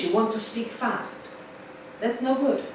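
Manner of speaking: ordinary talk, nothing marked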